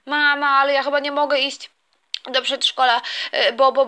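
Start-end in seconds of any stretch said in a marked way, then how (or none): none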